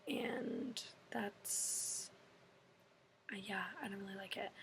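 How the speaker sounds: quick, dismissive whisper. Real, not acted. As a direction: mutter it off under your breath. Trailing aside about hating cardio.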